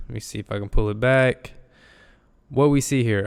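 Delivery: normal talking voice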